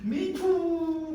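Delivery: high, drawn-out hammed-up wail; mock wailing. Master's wife falsely accusing Joseph before the servants, mock wailing. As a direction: wail theatrically, stretching each cry. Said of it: "갑자기 통곡을 하면서".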